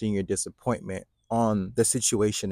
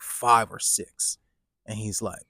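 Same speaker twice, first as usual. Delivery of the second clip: ordinary delivery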